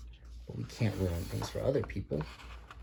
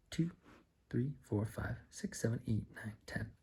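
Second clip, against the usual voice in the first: usual speaking voice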